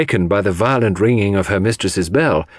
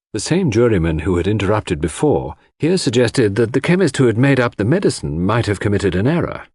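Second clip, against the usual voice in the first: ordinary delivery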